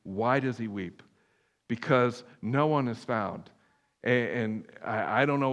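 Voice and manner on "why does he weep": normal talking voice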